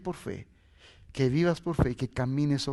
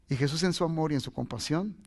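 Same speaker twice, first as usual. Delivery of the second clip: ordinary talk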